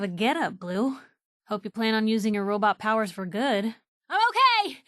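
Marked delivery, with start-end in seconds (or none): 4.1-4.8 high, bright call after a fall; brave reassurance. Acted. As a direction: shout that you're fine. After falling.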